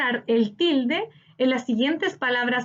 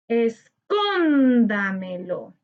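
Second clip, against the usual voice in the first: "Escóndamelo" slow, with a long, held CÓN; stressed syllable stretched; much slower than usual. Shown as a demonstration. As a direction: lean long on CÓN in "Escóndamelo", falling away after. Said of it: "Busquemos la sílaba tónica". ("Escóndamelo" alargando mucho la sílaba tónica CÓN)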